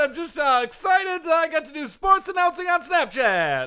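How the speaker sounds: Barry Vadalucci. Pretend. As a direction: shout it like an over-excited announcer. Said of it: "legendary announcer".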